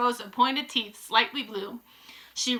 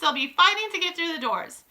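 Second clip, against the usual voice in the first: higher-pitched audience outcry; crying out; 7 semitones higher than usual. A narrator's reading. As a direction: call it out as an eager crowd. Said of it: "cried the audience".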